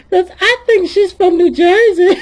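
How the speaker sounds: loud and tickled; laughing tease. Real, not acted. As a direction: say it while cracking up. Joking about fake delegate.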